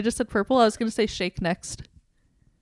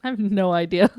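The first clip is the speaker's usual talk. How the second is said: ordinary talk, nothing marked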